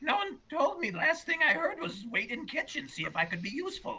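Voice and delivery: very high-pitched put-on character voice; Fabler. Servant explaining why he left his post, high character voice. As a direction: squeak out an excuse in character. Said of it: "Yeah, Fabler, you're supposed to be out front".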